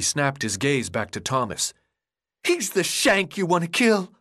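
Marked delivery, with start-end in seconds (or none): 2.4-4.1 high, manic raving madman. Acted. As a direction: rave it like you've lost your mind. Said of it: "His voice was full of madness".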